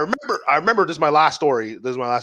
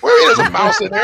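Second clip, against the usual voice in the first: high, louder, laughing through it; laughing disbelief; 5 dB louder than usual. Retold or imitated. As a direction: squeal it in amused disbelief. Retold dishwasher mouse report.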